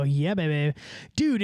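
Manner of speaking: normal talking voice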